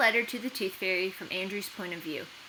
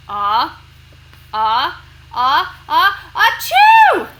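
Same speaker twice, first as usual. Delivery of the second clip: ah-ah-ah growing louder and higher into a loud achoo; building sneeze; 6 semitones higher, 16 dB louder, much slower than usual. Acted. Pepper up his nose, Andrew's building sneeze. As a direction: wind up a huge sneeze, then explode. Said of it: "Andrew went".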